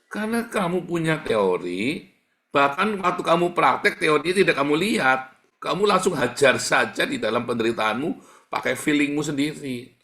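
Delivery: fiery preaching. Acted. Preacher pressing the congregation, forceful sermon voice. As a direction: preach it hard to the flock.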